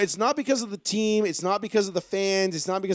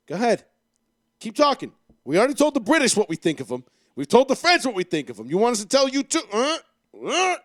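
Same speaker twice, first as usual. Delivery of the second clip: louder swaggering dare; mock taunt; 5 semitones higher, 5 dB louder than usual. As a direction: taunt a Canadian like a cocky American. American taunting Canadians, acted.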